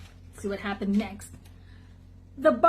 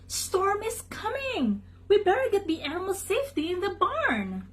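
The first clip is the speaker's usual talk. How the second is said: urgent warning; 9 semitones higher than usual. Acted. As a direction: sound the alarm, then hurry to the barn. Farmer warns of the coming storm.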